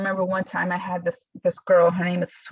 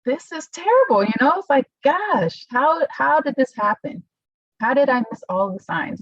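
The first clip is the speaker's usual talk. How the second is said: retold distress; 7 semitones higher than usual. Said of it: "I'm like".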